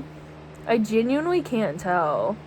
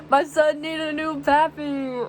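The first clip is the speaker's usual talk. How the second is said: put-on rapper voice; 5 semitones higher than usual. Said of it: "quoting lyric, put-on voice".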